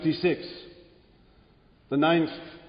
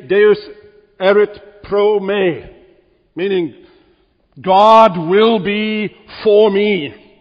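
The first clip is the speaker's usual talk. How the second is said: louder, slow, ringing declaration; slow preaching proclamation; 4 semitones higher, 6 dB louder, slower than usual. Acted. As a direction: let each word of the vow ring out. Preacher proclaims Luther's words slowly.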